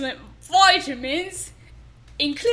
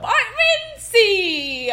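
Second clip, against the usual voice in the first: slow pirate voice; 6 semitones higher, 4 dB louder, much slower than usual. Pretend. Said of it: "this bit has really gotten way too long".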